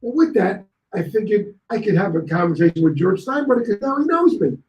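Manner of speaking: normal talking voice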